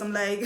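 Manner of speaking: a quick real laugh; short laugh. Real, not acted. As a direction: burst into a little laugh. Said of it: "I was even laughing at first".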